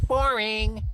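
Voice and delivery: put-on high bored kid mutter. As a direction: dismiss it like a bored girl. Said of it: "Talia muttered".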